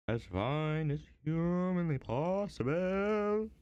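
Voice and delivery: slow theatrical echo of the last line; dramatic repeat. Shown as a demonstration. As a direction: stretch it out in a mock-serious voice. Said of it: "I might have to be a little quiet".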